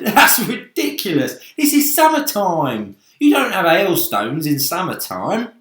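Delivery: a brash bug mocking the danger; cocky centipede. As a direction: centipede voice, scornful. Centipede voiced in story.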